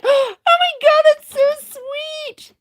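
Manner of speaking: very high, loud, slow gushing "oh my God"; gushing squeal. Retold or imitated. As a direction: squeal it like you're melting over something adorable.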